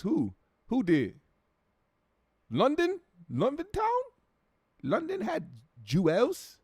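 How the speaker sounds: high-pitched London gangster impression; mock London accent. Mock London gangster confronting Juelz.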